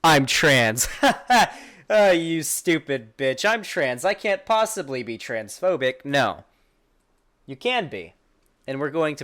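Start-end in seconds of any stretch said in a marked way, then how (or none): 0.0-0.9 loud, smug put-on voice; mocking impression. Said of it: "and your response is". 0.9-1.7 loud, high scoffing laugh; mocking laugh. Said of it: "your response is". 1.9-6.0 a hint of a smug sneer; mocking impression. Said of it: "and your response is".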